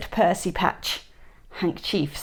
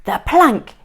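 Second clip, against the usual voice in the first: louder, higher pirate yell; 5 semitones higher, 7 dB louder than usual. A narrator's reading. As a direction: chant it out eagerly. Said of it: "yelled Ben Buckle and Percy Patch".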